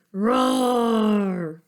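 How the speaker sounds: loud, voiced roar; bear roar. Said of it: "the bear said".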